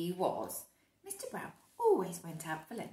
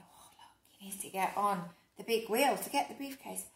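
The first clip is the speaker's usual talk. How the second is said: ordinary delivery, nothing marked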